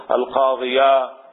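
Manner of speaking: "القاضية" slowly, ending in open -iya; fatha on the ya. Shown as a demonstration. Teacher explaining the accusative, fatha on the ya. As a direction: draw out "القاضية" with its final a. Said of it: "بالفتح على الياء".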